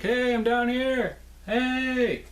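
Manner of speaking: high, slow shout to passing planes; stranded calling planes. Pretend. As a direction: call up to the sky for rescue. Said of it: "signal airplanes like".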